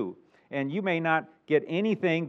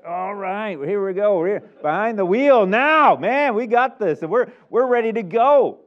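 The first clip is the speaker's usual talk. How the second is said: louder, higher swaggering voice, slow on the wheel line; cocky child driver; 7 semitones higher, 10 dB louder, slower than usual. Retold or imitated. Overconfident child at the wheel, loud swaggering boast. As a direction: hype yourself up like an overconfident teen. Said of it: "they're sitting back".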